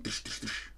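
voiceless, repeated percussive tish noises; quick soft hammering sound effect; imitated hammer blows. Shown as a demonstration. Teacher showing nails punching a pot, imitated hammer blows.

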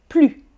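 "Plus" said "ply", final S silent; plus without S. Shown as a demonstration. Teacher repeats the silent-S form. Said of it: "La lettre finale n'est pas prononcée".

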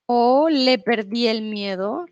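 normal talking voice

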